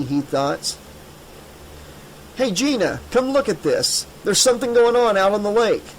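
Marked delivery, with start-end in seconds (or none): 2.3-5.9 high, raised call across the camp. Acted. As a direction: call her over from a distance. Bobby calls Gina from outside.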